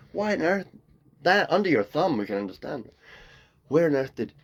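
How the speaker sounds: normal talking voice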